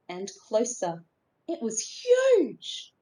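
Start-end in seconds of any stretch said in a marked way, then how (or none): 1.5-2.9 awed. A narrator's reading. Awe at huge shape.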